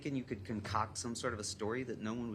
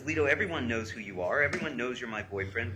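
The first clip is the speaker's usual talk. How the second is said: loud, insistent read-aloud; punched-up reading of an urgent message; emphatic reading; 2 semitones higher, 8 dB louder than usual. Retold or imitated. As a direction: read her text out forcefully. Reads girl's frantic texts aloud.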